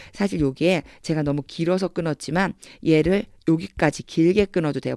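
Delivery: usual speaking voice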